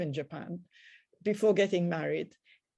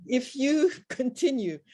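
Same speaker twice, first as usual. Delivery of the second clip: breaking into a laugh mid-threat, louder; laughing through it; 5 semitones higher, 5 dB louder than usual. Real, not acted. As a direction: chuckle as the words come out. Laughs while retelling her threat.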